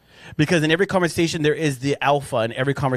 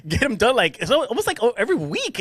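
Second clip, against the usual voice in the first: teasing; 9 semitones higher than usual. Real, not acted. Teasing about nails.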